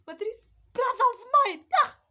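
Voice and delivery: high, loud cry of exasperation; exasperated outburst. Real, not acted. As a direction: burst out indignant at your brother. Exasperated outburst about the brother.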